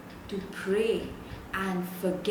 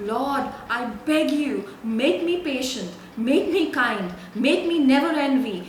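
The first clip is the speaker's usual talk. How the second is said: higher, louder, earnest begging prayer; pleading prayer; 5 semitones higher, 4 dB louder than usual. Pretend. Pastor models the new prayer for the church, pleading. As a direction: beg God for each virtue, pressing harder. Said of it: "what your prayer has become".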